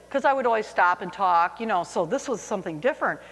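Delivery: ordinary talk, nothing marked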